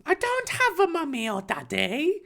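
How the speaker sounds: high-pitched little boy voice, mock-sad; Harry, sadly. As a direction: put on a high, sad child's voice. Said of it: "Harry replied sadly".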